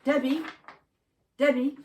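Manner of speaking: loud, high call across the garden; shouting a name. Acted. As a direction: call her in from the kitchen door. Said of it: "A voice shouted".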